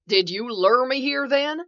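loud, high, rising question; alarmed accusation. A narrator's reading. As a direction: demand it in alarm. Trapped man accuses the figure.

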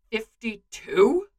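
slow, stunned question rising at the end; gaping disbelief. A narrator's reading. Bucky echoing the number Clint gave, gaping in disbelief. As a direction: echo it slowly in disbelief. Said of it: "Bucky gapes, brain stuttering".

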